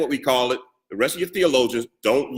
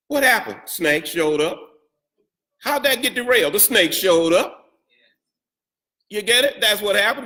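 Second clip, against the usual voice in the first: playful high call; 6 semitones higher than usual. Acted. Playful call and answer to congregation.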